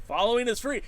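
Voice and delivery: high, loud playful plug; hyped pitch. Real, not acted. Streamer urging chat to follow, hyped pitch. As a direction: sell it like a hype man.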